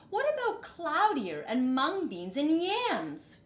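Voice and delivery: calling out ideas eagerly; excited cry. A narrator's reading. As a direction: villagers excitedly piling on ingredients. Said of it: "cried some others".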